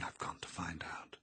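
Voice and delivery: hushed, urgent whisper to oneself; whispered thought. A narrator's reading. Eleanor hidden in the shadows, thinking in a whisper. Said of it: "she was thinking".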